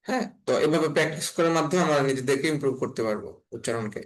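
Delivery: normal talking voice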